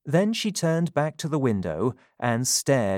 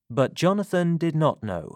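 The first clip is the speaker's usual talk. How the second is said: usual speaking voice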